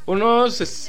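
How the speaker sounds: ordinary talk, nothing marked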